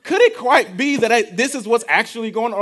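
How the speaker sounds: argument disguised as question. Acted. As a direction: push back while pretending to ask. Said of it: "but you say it as a question".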